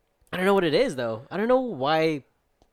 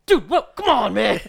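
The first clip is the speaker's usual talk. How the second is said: much higher and louder, laughing mock protest; mock disbelief; 9 semitones higher, 9 dB louder, slower than usual. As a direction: tease him like a friend who can't believe it. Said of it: "And then you're all like".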